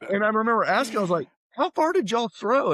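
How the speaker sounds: high-pitched awed disbelief; awestruck. Retold or imitated. Small freshman meeting huge throwers, awed high voice. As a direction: gape at the giant, voice climbing.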